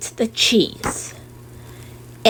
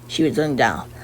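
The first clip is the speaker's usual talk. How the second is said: plain reading voice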